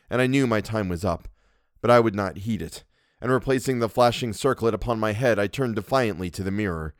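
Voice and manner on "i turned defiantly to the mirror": ordinary delivery, nothing marked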